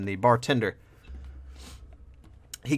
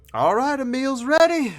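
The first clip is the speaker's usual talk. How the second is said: much higher barmaid call; 5 dB louder than usual. Acted. Barmaid announcing food, high-pitched call. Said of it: "And she's like".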